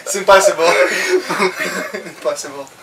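laughing. Real, not acted. Gives up laughing.

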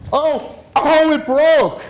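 high, loud mock dismay; surprised. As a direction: gasp as if it broke by accident. Flower shatters in demo.

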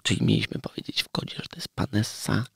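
hushed whisper, keeping a secret. Real, not acted. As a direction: whisper so nobody hears. Whispered secret about guest.

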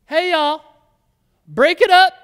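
high, loud call to the crowd. Retold or imitated. Jesus telling the mourners to stop, loud call. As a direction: break up the mourners. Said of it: "And Jesus is like".

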